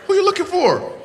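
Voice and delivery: laughing shout into the room; calling out. Real, not acted. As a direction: yell across the crowd for Hazel. Calling for a lost girl.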